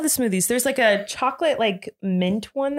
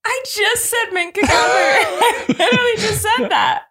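laughing; 8 semitones higher, 4 dB louder than usual. Real, not acted. Mock-exasperated argument over flavor.

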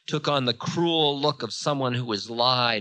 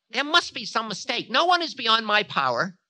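high, affronted protest; Time, protesting; 13 semitones higher, 6 dB louder than usual. A narrator's reading. Time insisting no one escapes him, protesting.

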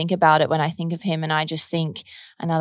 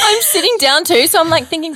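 laughing; 11 semitones higher, 7 dB louder than usual. Real, not acted. Laughing about nearly fainting.

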